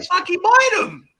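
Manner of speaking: sudden high, loud comic yell; high, loud joking burst; mock outburst. Real, not acted. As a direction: blurt the punchline loudly. Joking about selling games.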